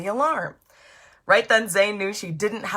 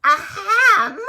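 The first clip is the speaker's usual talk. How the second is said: high squeaky throat-clear; small crab ahem; 13 semitones higher, 10 dB louder than usual. Acted. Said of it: "a small voice cry".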